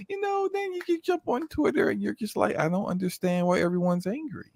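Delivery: mocking the writer on Twitter, high voice. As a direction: tease Justin in a high voice.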